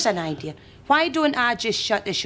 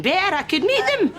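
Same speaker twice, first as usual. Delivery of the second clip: eager squeal; 10 semitones higher than usual. Acted. Overeager shopkeeper squeals.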